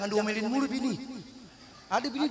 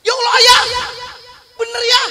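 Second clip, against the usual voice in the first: high, loud joyful cry; wife overjoyed to see her name; mock overjoyed wife; 11 semitones higher, 9 dB louder than usual. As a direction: burst out with joy.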